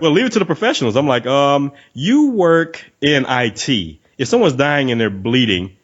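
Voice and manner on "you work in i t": very slow, spelled-out reminder; pointed condescension. Retold or imitated. Gym-goer to on-call worker, slow mock-patient put-down. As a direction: drag it out as if stating the obvious. Sarcastic reply to interrupting worker.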